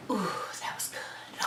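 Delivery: very soft, whispered sigh of relief; hushed, soothed murmur; acted relieved whisper. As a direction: whisper it as the pain eases. Acting out relief from iced hand.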